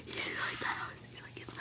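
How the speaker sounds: hushed terrified whisper; whisper, hiding from the killer; whispering under a desk. Acted.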